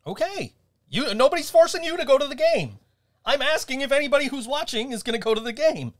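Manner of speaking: high-pitched, exasperated protest; exasperated arguing. Real, not acted. Host argues with co-host over the game, exasperated.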